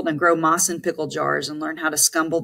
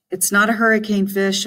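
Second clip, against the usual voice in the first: higher, pressing impatience; impatient; 5 semitones higher than usual. A narrator's reading. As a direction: brush off his worry. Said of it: "I said impatiently".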